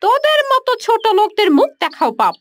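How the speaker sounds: high, sneering put-down; contemptuous scolding. Acted. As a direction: look down on her and spit the words. Rich woman scolds poor neighbor. (তাচ্ছিল্যভরা ধমক)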